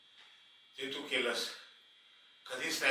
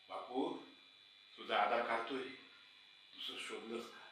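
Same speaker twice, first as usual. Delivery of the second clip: very soft, lower, sinking voice; hurt, hushed repeat; 4 semitones lower, 12 dB quieter than usual. Acted. Servant repeats the question his master never asked, quietly hurt. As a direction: let the imagined question fade with hurt.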